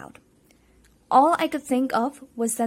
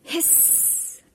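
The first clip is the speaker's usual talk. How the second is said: hissing; 5 semitones higher, 9 dB quieter than usual. A narrator's reading. Monster struck by sword.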